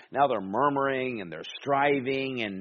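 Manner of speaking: usual speaking voice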